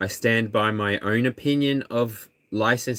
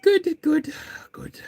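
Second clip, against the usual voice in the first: very high soothing goods; slower than usual. Real, not acted. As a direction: coo praise at the pet. Talking to pet.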